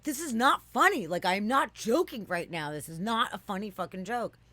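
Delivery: high, loud insistent protest; retold teen protesting the laughing adults; indignant shout. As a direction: yell that it is no joke.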